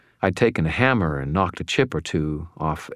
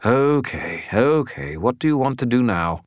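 higher, giving-in spirit voice; resigned skull voice; 6 semitones higher than usual. A narrator's reading. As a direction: give in like a weary skull. Bob the skull gives in.

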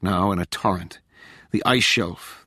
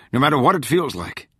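firm urging in a higher pitch; insist, lifting the pitch; insistent; 6 semitones higher, 3 dB louder than usual. A narrator's reading. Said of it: "the man insisted".

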